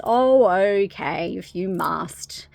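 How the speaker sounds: slow, drawn-out mock resignation; grudging consent. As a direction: give in with a long, put-upon "oh". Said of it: "And he goes".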